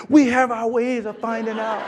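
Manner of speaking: mimicking a southern girl with attitude; sassy southern girl. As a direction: give it that head-wobble attitude. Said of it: "girls down south, they talk with their necks in their hand".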